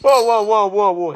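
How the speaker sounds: rising alarmed calls; startled, backing off fast; alarmed whoa. Acted. Calming angry brother down.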